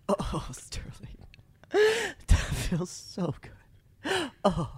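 breathy, whispered mock pleasure; mock lover's moaning. Shown as a demonstration. Testing whether co-host gets turned off, whispered mock moaning. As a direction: whisper it slowly as hammed-up moaning. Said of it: "now it's like Beavis and Butthead".

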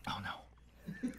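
breathless whispered dread; pained whisper. Real, not acted. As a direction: whisper it, mouth on fire. Reacting to burning hot sauce.

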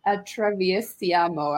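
put-on slow, halting stab at an Italian accent; Italian accent attempt. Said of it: "you could do a better accent than that".

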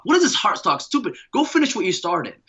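a mock-angry father sneering back at his son; scornful dad. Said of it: "And he was like".

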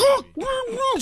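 high strangled humming; desperate muffled hums; choking hums. Retold or imitated. Choking woman voiced.

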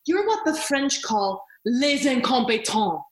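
lightly mock the smug teen sneer; snooty older sister. Quoting the Home Alone sister to Macaulay Culkin, lightly snooty. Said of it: "She is the older sister in Home Alone who says".

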